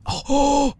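high, drawn-out "oh" of surprise; surprised gasp. Real, not acted. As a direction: gasp at a lucky find. Finds an axe on body.

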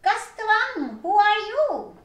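high, loud call to a stranger; shouting. Acted. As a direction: yell it across the field. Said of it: "चिल्ला के बोले".

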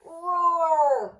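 loud high dinosaur roar; bellowing roar. A narrator's reading. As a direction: roar to scare off the sharks. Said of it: "bellowed Dinosaur Book".

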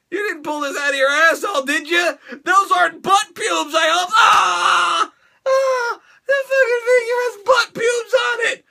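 high, loud comic disgust; mock-horrified outburst. Recoiling from the figure's hair, high mock-horrified shout. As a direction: wail it in hammed-up horror.

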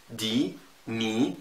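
دي ني ممدودة ببطء; مد الإي في دي وني; long ie. Shown as a demonstration. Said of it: "أمثلة بقى". (نطق دي ني بإي ممدودة)

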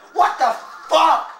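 slow, loud, high exclamation; angry outburst. Pretend. Gamer cursing at the game, angry outburst. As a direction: punch each word out in exasperated rage.

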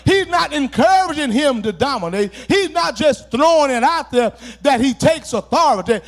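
loud, driving sermon cadence; fervent pulpit shout; preaching at full force. Acted. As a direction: preach it hard and rising to the congregation. Climax of the sermon.

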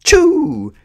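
very high, loud creature shriek; loud, squealed attack call; Pikachu yell. A narrator's reading. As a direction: release it as a piercing battle cry. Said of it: "Pikachu yelled".

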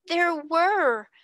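stout insistence. A narrator's reading. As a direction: insist it is true. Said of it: "she said stoutly".

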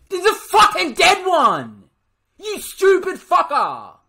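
high-pitched, full-volume scream; furious shout. Real, not acted. Player cursing a stupid teammate, furious shout. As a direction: explode in real rage.

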